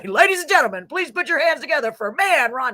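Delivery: rally the crowd's applause for the act; stage announcer. Pretend. Host rehearsing Ron's stage introduction, announcer voice. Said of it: "get up on the stage and announce you".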